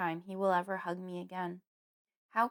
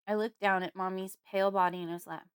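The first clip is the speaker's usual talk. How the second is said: plain reading voice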